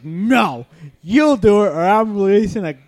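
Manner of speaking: loud mock-stern threat; teasing boss threat. Boss teasing the waitress with a spider threat, loud mock-stern. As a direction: threaten her with a grin. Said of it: "he was like".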